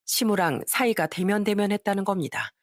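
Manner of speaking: usual speaking voice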